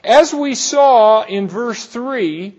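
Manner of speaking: usual speaking voice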